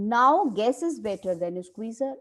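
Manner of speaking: slow, deliberate reading of a play line; dramatic play reading. Acted. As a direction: read it out slowly like a script. Teacher reads play aloud.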